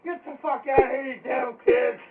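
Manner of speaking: high, loud screaming rage at the door; shrieking angry old man; furious shout. Retold or imitated. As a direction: bellow like a man caught on the toilet. Angry man yelling at intruders.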